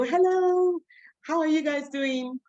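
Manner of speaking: bright high teacher greeting. Shown as a demonstration. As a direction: greet a class of small kids. Teacher greeting five-year-olds.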